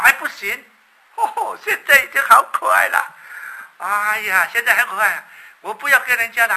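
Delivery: high, loud flustered protest; mother's urgent refusal. Acted. Mother loudly refusing to give away her baby. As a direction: blurt out no, she's too cute now.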